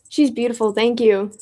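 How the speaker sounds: ordinary talk, nothing marked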